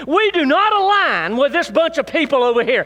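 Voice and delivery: high, loud, fervent preacher voice; full-force preaching. Acted. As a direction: preach it to the whole church.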